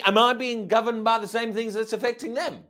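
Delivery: usual speaking voice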